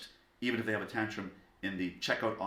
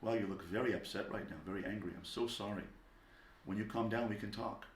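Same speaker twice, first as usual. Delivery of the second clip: quiet, understanding parent; sympathetic; 6 dB quieter than usual. Acted. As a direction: gently soothe an upset child. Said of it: "acknowledge the feeling".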